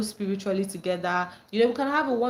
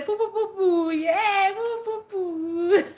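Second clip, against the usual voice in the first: high, slow, laughing chant; giggly high poo-poo chant; playful exclamation; 10 semitones higher, slower than usual. Retold or imitated. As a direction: sing it out teasingly. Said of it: "'Cause I'm saying".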